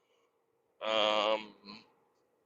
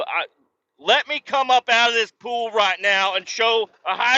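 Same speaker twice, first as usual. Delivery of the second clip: higher, rising angry tirade; retold furious threat; 9 semitones higher, 3 dB louder than usual. Dad threatening a high school lifeguard, mock fury. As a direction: threaten the teen lifeguard, boiling over. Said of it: "I'm like".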